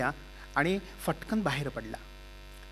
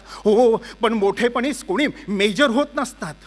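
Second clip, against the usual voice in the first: bitter sneer; 4 semitones higher, 7 dB louder than usual. Acted. Bitter retort.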